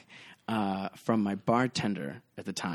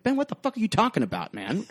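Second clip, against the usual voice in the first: puzzled outburst; baffled young self; 10 semitones higher than usual. Retold or imitated. Bartender imitating his younger confused self to Ben, high protest. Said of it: "He's like".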